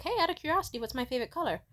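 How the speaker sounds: high, casual mock-innocence; retold sweetly casual query; innocent test question. Acts herself innocently testing him.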